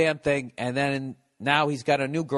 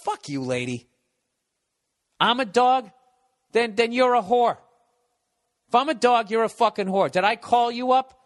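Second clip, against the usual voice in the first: loud, raised-pitch rant at her; angry telling-off; 5 semitones higher, 3 dB louder than usual. Pretend. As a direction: tear into her like you're fed up. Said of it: "you really should have come at her like that".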